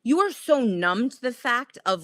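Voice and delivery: normal talking voice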